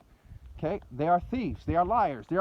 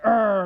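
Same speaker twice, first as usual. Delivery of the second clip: loud mock-aggressive "aah"; angry brute roar; 2 semitones higher, 9 dB louder than usual. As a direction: imitate a brute's wordless bellow. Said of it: "is the best way to describe it".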